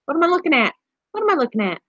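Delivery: high, puzzled pet voice; voicing the guinea pig. Owner voicing her confused guinea pig. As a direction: wonder aloud as the little pet. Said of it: "she's like".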